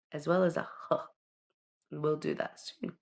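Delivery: normal talking voice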